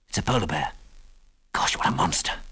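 low whisper of alarm; hushed awe. A narrator's reading. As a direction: breathe it low, so the bear won't hear. Spotting a bear, hushed.

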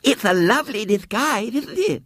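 put-on very high, lisping little girl; small shrill voice. A narrator's reading. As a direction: show it off in a piping child voice. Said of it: "shrill voice".

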